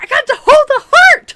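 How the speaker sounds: very high, loud laughing squeal; imitating an overjoyed nerd; ecstatic squeal. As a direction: scream with pure joy. Retelling excited Tendi.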